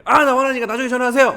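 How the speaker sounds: brushing off a caller in mock irritation; mock irritated phone reply. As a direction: pretend to bite the caller's head off. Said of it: "막 이렇게 안 할 거 아니에요".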